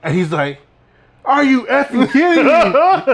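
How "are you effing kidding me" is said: drawn-out, high, loud exasperated outburst; mad teacher. Retold or imitated. Teacher finding out the phone is fake, mock furious. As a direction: imitate a teacher at the end of his patience. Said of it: "and he got mad".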